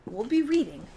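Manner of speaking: ordinary delivery, nothing marked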